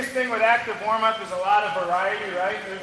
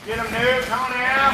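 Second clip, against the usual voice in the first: encouraging shout; 5 semitones higher, 7 dB louder than usual. Real, not acted. Coach cheering player on.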